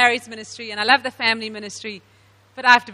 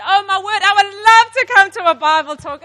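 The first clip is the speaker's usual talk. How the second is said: higher, louder eager acceptance; delighted yes; 6 semitones higher, 7 dB louder than usual. Retold or imitated. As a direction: gush with delight. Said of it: "She said".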